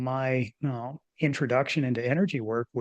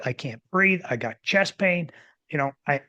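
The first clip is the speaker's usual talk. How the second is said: usual speaking voice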